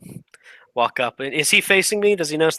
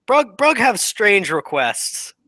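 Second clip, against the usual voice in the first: awkward brute; 8 semitones higher, 3 dB louder, slower than usual. Acted. Half-orc Brug asks awkwardly.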